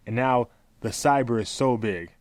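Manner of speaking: deliberate, evenly paced quote; slow read-aloud. Shown as a demonstration. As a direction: read the quote out slowly and straight. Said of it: "I'm gonna read this very slowly".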